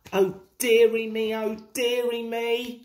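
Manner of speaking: mild plaintive groan; groaning dismay. A narrator's reading. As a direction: lightly groan in despair. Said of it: "groaned Thomas".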